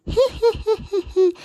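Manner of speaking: high sneering heh-heh; gloating cackle. Acted. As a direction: cackle in triumph. Buffalo gloats laughing.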